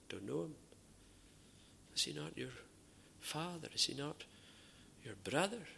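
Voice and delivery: soft voiced denial and questioning; hushed exchange. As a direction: keep it hushed, as if overheard in the street. Denial and challenge acted out.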